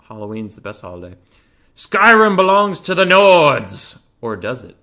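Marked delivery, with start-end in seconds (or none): 1.7-4.0 high, loud, drawn-out rallying cry; battle-cry shout. Acted. Streamer proclaiming a game slogan in jest, roaring shout. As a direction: bellow it like a rebel slogan.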